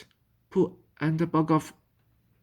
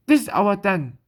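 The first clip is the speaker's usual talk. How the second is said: louder, higher, indignant claim; upset, possessive protest; 5 semitones higher, 7 dB louder than usual. A narrator's reading. Child upset that others know of the den, indignant. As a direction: protest it firmly, raising pitch and volume.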